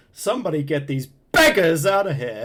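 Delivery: hammed-up imitation of an actor's outburst; over-exaggerated movie line. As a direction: overplay the line, punching BEGGARS loud and long. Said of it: "really over-exaggerates it".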